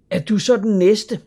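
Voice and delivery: high, loud alarmed question; sudden worry for her friend; Asta, alarmed. A narrator's reading. (højt, forskrækket spørgsmål)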